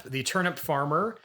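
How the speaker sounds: usual speaking voice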